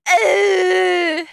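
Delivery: high, loud crying wail; crying sob. Real, not acted. Sentimental vlogger sobbing to camera. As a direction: let the sob out. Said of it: "I'm a big sap".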